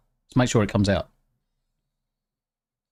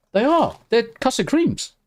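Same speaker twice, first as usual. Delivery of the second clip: excited; 10 semitones higher than usual. Real, not acted. Confirming the biscuit taste.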